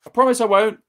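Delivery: high, loud sweet reply; promising boy calling out; calling back. Acted. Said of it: "George answered".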